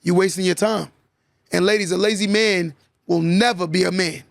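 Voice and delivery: ordinary talk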